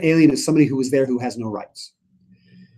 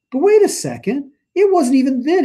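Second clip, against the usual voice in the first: much higher, startled turn; sudden realization; 12 semitones higher, 5 dB louder than usual. Acted. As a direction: catch yourself mid-prayer.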